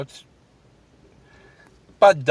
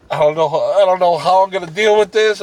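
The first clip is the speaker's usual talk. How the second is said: mildly hammed-up panic, louder and higher; imitated panicky rant; 6 semitones higher, 6 dB louder than usual. Corey rants about the haunted house, imitated panicky rant. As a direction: rant it like a rattled friend, retold.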